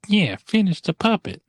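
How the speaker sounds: high-pitched mock henchman echo; Oompa Loompa. Oompa Loompa egging on the bully, high piping voice. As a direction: pipe up high like an eager little sidekick. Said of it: "the Oompa Loompa's like".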